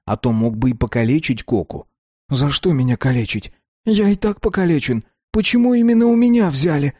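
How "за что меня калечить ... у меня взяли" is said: high, tearful whining; whiny. A narrator's reading. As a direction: plead like he's about to cry. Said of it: "плаксиво спрашивал Кока".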